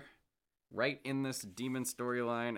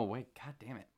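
usual speaking voice

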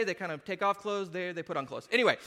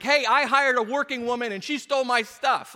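loud, higher-pitched indignant announcement; mock public outcry; 7 semitones higher, 10 dB louder than usual. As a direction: broadcast the grievance to the whole street. Voicing an embarrassing public complaint.

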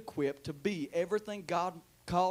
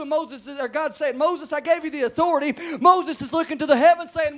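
building, higher and louder pulpit voice; fervent preacher gathering force; impassioned preaching; 8 semitones higher, 13 dB louder than usual. Real, not acted. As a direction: drive the story forward with rising urgency.

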